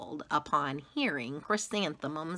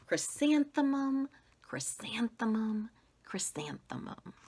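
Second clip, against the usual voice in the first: fading to whisper; 6 semitones higher than usual. A narrator's reading. Girl whispering her own name to the mirror, fading. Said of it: "whispered it to herself".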